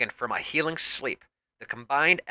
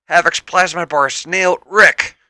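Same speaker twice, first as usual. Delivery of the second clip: calling out; 5 semitones higher, 6 dB louder than usual. A narrator's reading. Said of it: "one X-Force member calls out".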